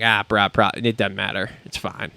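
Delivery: low, softening mock-casual mumble; imagined unbothered reply. Host imagining a foreign podcaster shrugging off the controversy, unbothered. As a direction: wave it off, voice dropping away to nearly nothing. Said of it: "he'd be like".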